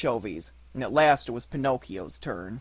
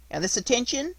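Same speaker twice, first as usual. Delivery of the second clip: high puppet voice; 10 semitones higher than usual. Acted. Puppet asks fisherman.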